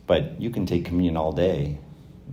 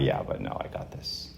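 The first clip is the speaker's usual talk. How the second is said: stubborn whisper; 5 semitones lower, 7 dB quieter than usual. Acted. Stubborn believer refusing God.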